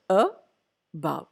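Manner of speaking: "above" split into a, bove with a gap; syllable by syllable. Shown as a demonstration. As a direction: break "above" into two separate syllables. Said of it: "two syllable word".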